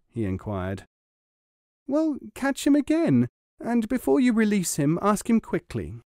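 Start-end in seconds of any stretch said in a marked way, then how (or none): 1.9-6.0 high, eager wife's voice; higher pitched wife voice. A narrator's reading. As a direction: press him like a scheming wife.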